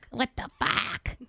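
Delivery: high, slow mock toddler exclamation; imitating a toddler. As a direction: stretch it out like a kid's catchphrase. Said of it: "his new favorite thing is just, like, going".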